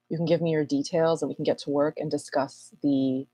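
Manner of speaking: ordinary talk, nothing marked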